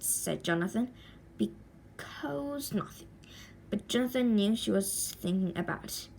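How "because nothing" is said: slow hushed whisper, holding back; secretive whisper. Acted. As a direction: whisper it so the boy won't pry. Aunt hides her worry about bears.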